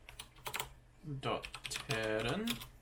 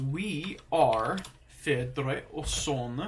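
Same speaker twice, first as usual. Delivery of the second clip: slow careful repeat; 4 dB louder, much slower than usual. Shown as a demonstration. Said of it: "slow repetition while learning".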